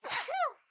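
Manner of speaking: sudden loud shocked gasp; loud startled intake of breath; sharp gasp. Real, not acted. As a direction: gasp in alarm. Panicked at screen setup.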